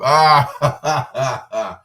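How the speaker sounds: high, loud gleeful shout; sudden loud laughing exclamation; loud delighted cry. Real, not acted. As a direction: yelp out in delight. Laughing at dance move memory.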